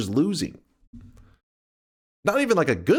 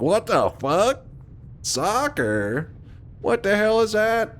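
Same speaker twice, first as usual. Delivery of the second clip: mock confusion; 9 semitones higher, slower than usual. Imitating confused Americans.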